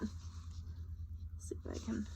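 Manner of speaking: hushed, under the breath; whispered aside. Real, not acted. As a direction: whisper to yourself, slow. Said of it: "aside while handling book".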